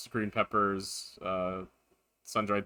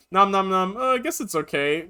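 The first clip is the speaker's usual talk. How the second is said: mock reluctant eating; 7 semitones higher, 4 dB louder than usual. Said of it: "I was like".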